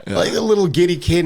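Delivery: usual speaking voice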